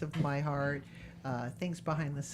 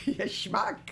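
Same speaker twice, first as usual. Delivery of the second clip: higher comic mock insult; lightly voiced manic comedian; Jerry Lewis; 6 semitones higher than usual. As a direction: toss out a goofy mock berating. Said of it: "kinda, like, Jerry Lewis".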